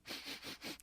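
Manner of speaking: a quick sharp sniff of the air; a demo sniff for the stranger. Shown as a demonstration. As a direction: sniff like you can smell the rain. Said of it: "I sniff the air, like that".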